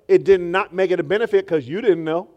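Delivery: usual speaking voice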